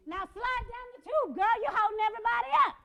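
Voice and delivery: high, loud impatient mother; yelling at child. Acted. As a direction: holler at your kid across the playground. Mother yelling at kid on slide.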